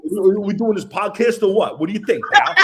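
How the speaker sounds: hammed-up wiseguy Italian accent; fake Italian. Co-host teases the host's put-on Philly talk, hammy Italian accent.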